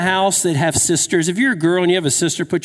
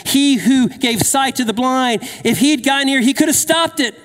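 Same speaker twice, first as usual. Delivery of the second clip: mocking leaders; 9 semitones higher than usual.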